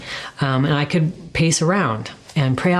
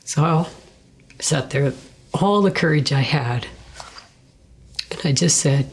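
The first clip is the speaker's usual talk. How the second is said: ordinary talk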